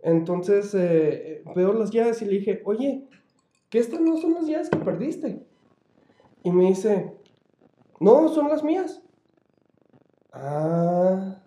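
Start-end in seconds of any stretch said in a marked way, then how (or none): 2.7-5.4 high, rising disbelief; pointing out the lost keys, surprised; surprised question. Retold or imitated. Asking coworker about the keys. 8.0-9.0 high, loud "no, they're mine"; insistent denial. Retold or imitated. Coworker denies keys are his.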